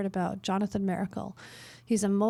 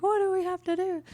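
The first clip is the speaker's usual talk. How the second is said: exasperated; 10 semitones higher, 3 dB louder than usual. Retold or imitated. Leader exasperated by more criticism.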